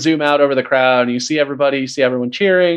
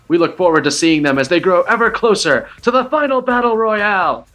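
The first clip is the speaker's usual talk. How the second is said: raised, higher arena announcement; announcer shout; 7 semitones higher than usual. Acted. Viridia shouting the victory over the arena crowd. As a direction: proclaim it to the whole arena. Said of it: "and shouts".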